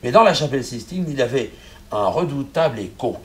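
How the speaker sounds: ordinary talk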